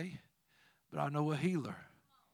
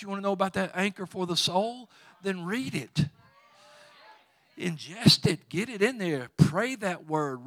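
rising, louder preacher cadence pressing the crowd; building preaching; 3 semitones higher, 6 dB louder than usual. Acted. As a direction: preach it harder line by line, urging them to read. Preaching builds to full force.

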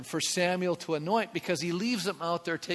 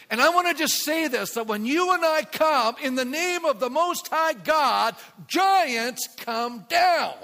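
loud, high, rising preacher's call; preaching at full force; 9 semitones higher, 6 dB louder than usual. Real, not acted. Pastor preaching to his congregation, fiery and loud. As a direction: lift the room with fiery conviction.

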